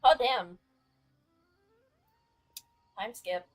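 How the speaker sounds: usual speaking voice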